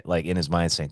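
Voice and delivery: ordinary talk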